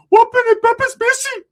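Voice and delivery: very high, loud frantic gibberish voice; squeaky Ricky. Retold or imitated. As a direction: babble it high and panicked.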